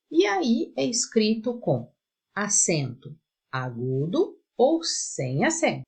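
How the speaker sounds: ordinary talk, nothing marked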